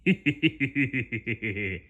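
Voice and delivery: contained villain snicker; long, held-in evil "hee-hee-hee"; muffled evil laughter. Acted. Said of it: "He muffled his evil laughter".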